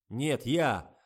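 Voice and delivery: loud sarcastic squeal; sarcastic shriek. A narrator's reading. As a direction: snap back mockingly. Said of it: "взвизгнул режиссёр".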